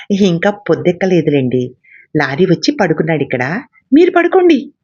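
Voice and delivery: ordinary delivery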